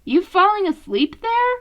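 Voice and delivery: a light nudge at a dozing brother; teasing question. Acted. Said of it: "Leo asked".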